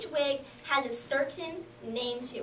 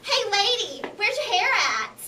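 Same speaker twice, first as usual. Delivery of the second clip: put-on curious little boy; 6 semitones higher, 3 dB louder than usual. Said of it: "a kid came up to me and he goes".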